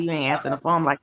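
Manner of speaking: ordinary talk, nothing marked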